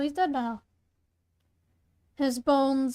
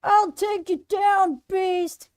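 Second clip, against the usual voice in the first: high, loud challenge; heroic battle cry; 6 semitones higher, 5 dB louder, slower than usual. Acted. Hunter bursts from hiding at the beast, high defiant shout. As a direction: shout down the monster.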